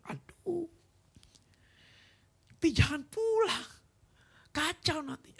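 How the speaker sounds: very high, distressed retold plea; wailing, high-pitched appeal; frantic pleading. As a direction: imitate yourself begging in panic.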